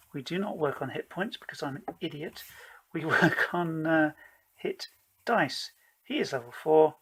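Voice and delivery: ordinary delivery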